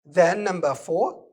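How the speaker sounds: usual speaking voice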